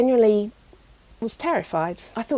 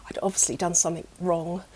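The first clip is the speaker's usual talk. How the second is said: hushed whisper; 5 semitones lower, 6 dB quieter, faster than usual. Real, not acted. Drops to whisper on fault.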